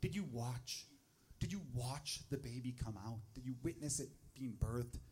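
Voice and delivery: soft, low, imitated prying question; nosy questioner. People ask new dad about the birth, hushed prying. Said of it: "people ask me this question".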